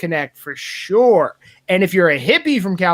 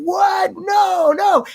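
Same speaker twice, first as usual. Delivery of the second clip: mock shocked protest; 9 semitones higher, much slower than usual. Guys reacting to Milo's stories.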